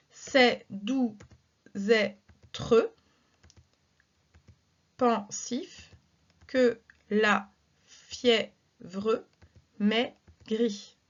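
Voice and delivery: line read slowly with long pauses between words; teacher spacing out a verse to count syllables; syllables spaced out. Shown as a demonstration. Teacher slowly reads a verse aloud to count its syllables. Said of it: "Ici encore, j'ai douze syllabes".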